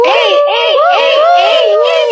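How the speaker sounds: high-pitched whooping chant; excited high cheering; party hype. Acted.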